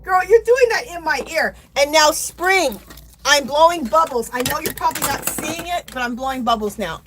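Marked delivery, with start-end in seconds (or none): 0.0-1.5 high, loud sassy complaint; mock complaint. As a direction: playfully scold her for the noise. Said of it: "You're like".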